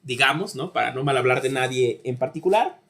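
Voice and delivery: usual speaking voice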